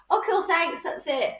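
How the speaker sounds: very high, cheery mock thanks; breezy brush-off. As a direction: toss out a high, chirpy goodbye. Acting a cheerful exit after rejection.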